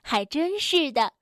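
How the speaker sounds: usual speaking voice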